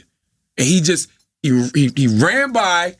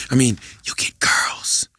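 hushed, slow whispered aside; drops to a secret whisper, looking around; 7 semitones lower, slower than usual. Retold or imitated. Retelling Shia LaBeouf leaning in to whisper the correction.

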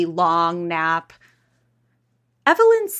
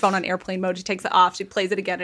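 ordinary delivery

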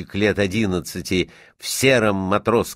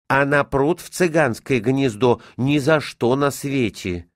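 lightly voiced grumbling maid, pitched up; stubborn housemaid; 6 semitones higher than usual. A narrator's reading. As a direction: play a maid balking at an errand.

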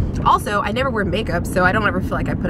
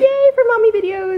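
cheer; 13 semitones higher, 4 dB louder than usual. Real, not acted. Cheering along with guest.

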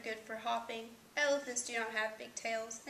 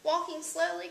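high, louder exclaimed question; pitched up, astonished at the idea; crying out; 6 semitones higher, 5 dB louder than usual. A narrator's reading. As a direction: call it out in surprise. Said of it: "cried Hugo".

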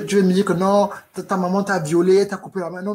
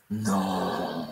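voix bizarre; 9 semitones lower, 7 dB quieter than usual. Acted.

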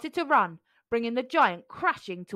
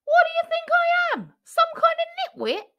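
very high, outraged squeak; indignant pea; 6 dB louder than usual. A narrator's reading. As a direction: shriek the insult in disbelief. Jack indignant at mango.

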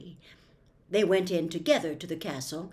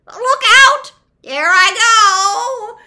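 very high, loud shriek; piercing, drawn-out witch wail; dying witch's scream; 13 semitones higher, 18 dB louder, much slower than usual. A narrator's reading. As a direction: scream out the last warning, drawing out the end. Witch screams as she melts.

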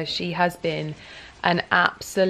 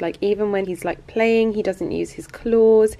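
normal talking voice

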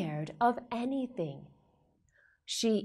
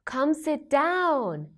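bright, high, sing-song welcome; reading to a toddler; 9 semitones higher, slower than usual. Acted. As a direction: greet little kids brightly. Greets children warmly.

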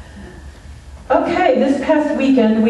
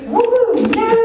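high whooping cheer; excited cheer; 13 semitones higher than usual. Real, not acted. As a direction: whoop it up with joy. Cheering for returned members.

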